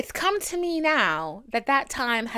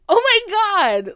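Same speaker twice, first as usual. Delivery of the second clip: mock shock; 10 semitones higher, 8 dB louder, slower than usual. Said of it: "I'm like".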